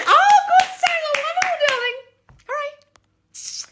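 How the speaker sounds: very high, hammed-up posh squeal; mock falsetto darling voice. Coach gushing back at a viewer in squeaky falsetto. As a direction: trill it like a gushing society lady.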